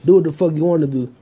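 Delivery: usual speaking voice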